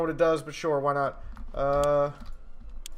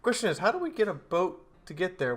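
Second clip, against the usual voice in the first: ordinary delivery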